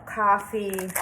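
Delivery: usual speaking voice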